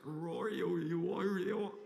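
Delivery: loud odd ancient chant; God's ancient language. Retold or imitated. God speaks his own ancient language, loud and strange. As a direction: intone it like an alien god tongue. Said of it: "God Phoenician alphabet that's like".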